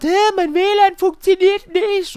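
high, loud, slow mock-outraged customer; upset customer. Customer complaining on the phone, mock outraged squeal. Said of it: "mega aufgebracht".

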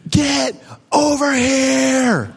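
slow, stretched-out mock holler; dad calling across the woods, acted; imitated yell back. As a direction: draw each word out to carry far. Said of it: "He yelled back".

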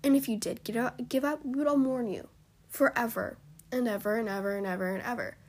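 normal talking voice

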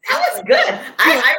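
laughs high and loud, delighted; cracking up at her own line; giggling with pride; laughing. Real, not acted.

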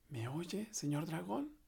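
soft, hushed and high; very softly. Acted. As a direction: check gently if he sleeps. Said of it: "le dijo muy suavemente". (muy suavemente)

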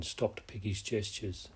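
plain reading voice

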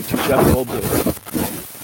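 crackly cordless phone; breaking up with static; staticky line. Real, not acted. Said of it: "Ah, you're all staticky".

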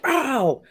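sharp high yelp, imitating the actor; a quick pained cry, mimicked; mock yelp. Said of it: "the guy's like".

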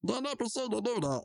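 ordinary talk, nothing marked

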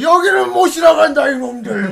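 slow, loud, high grandpa yell; old man shout. Retold or imitated. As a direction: bellow like a stubborn old man. Old man blocking construction crew.

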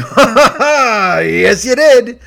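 loud, high mock laugh, words drawn out; forced laughing agreement. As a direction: laugh it off too hard, exaggerated. Retold forced laugh at gloating winner.

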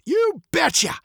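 put-on high, gravelly old man's voice. A narrator's reading. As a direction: muse like a muddled old man, high and gravelly. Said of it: "his voice as old and gravelly as his face".

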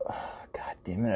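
whispered, under the breath; muttered curse. Retold or imitated. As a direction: curse softly to yourself, worn out. Tired customer mutters at checkout.

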